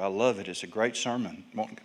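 ordinary delivery

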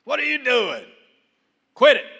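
Jesus scolding the wind, mock-sharp; sharp rebuke. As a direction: scold it like a parent stopping a child. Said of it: "He spoke to it sharply".

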